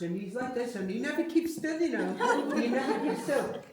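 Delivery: laughing loudly while talking; bursting into laughter. Real, not acted. Psychic laughing about lively spirit.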